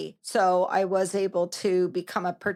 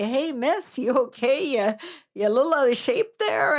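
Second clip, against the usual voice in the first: lightly voiced cheeky teen, pitched higher; a hint of a student poking fun at the teacher; playful mock teen jab at miss; teasing teenager; 5 semitones higher, 3 dB louder than usual.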